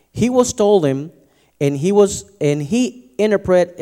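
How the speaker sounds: lightly formal reading voice; scripture reading. Acted. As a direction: proclaim the passage to the church. Reads Genesis aloud.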